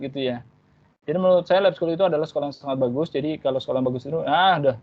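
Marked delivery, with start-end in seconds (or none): 1.0-4.2 rushed, fast answer; hurried nervous answer; too fast. Shown as a demonstration. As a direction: rattle it off too quickly. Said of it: "jangan cepet-cepet".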